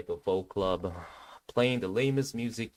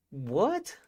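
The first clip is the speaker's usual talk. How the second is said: shocked; 8 semitones higher than usual. Retold or imitated. Acted shock at the spot.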